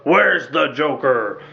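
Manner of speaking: slow, low gravelly growl; gravelly Batman voice. Retold or imitated. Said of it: "I like that gravelly voice".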